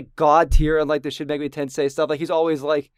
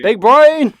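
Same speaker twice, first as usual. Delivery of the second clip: mocking shout; 5 semitones higher, 10 dB louder than usual. Real, not acted. Teasing a friend.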